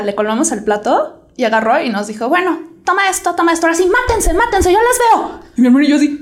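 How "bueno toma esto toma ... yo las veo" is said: climbing higher, exasperated mock mom; a hint of a mother who's had enough; sarcastic mom voice rising higher and higher; fed-up mom. Fed-up mom hands over weapons.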